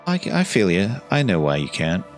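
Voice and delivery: normal talking voice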